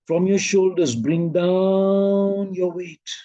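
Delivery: DOWN stretched very long; emphasized guiding cue. Shown as a demonstration. Teacher guiding weight from the shoulders, slow and stretched. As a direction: draw DOWN out slowly, as if lowering a weight. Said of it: "You see, I'm emphasizing".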